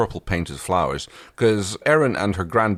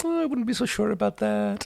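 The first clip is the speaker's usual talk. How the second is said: higher, smug villain voice; sly villain Scorpius; 3 dB quieter than usual. Retold or imitated. Quoting the villain taunting the hero, in a higher smug voice. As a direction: purr it like a smug, knowing villain. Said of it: "And Scorpius is like".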